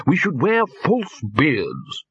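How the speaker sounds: triumphant. A narrator's reading. Lecturer proposing disguise to wizards, triumphant. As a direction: draw out the clever idea triumphantly. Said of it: "said the lecturer in recent runes triumphantly".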